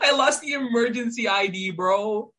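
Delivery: high laughing exclamation. Real, not acted. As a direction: laugh through the words. Laughing at own loss.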